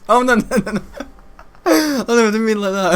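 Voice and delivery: high, loud, laughing no, no, no; laughing denial. Real, not acted. Vlogger laughing off his own slip, flustered. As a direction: giggle out a flustered take-back.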